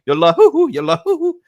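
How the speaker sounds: high, playful celebrating la hoo hoo; giddy singsong. Pretend. Phil flying to Munich for his medical, giddy singsong. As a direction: bounce the syllables like a happy chant.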